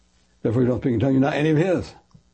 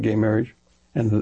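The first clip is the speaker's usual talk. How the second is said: ordinary talk, nothing marked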